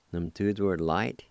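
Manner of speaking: ordinary talk, nothing marked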